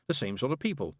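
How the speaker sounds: ordinary delivery, nothing marked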